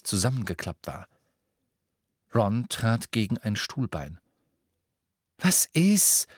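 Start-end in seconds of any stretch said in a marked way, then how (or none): 5.4-6.3 high-pitched testy challenge; grumpy, irritated retort; growled irritation. A narrator's reading. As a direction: snap back crossly at your friend. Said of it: "knurrte er Hermine an".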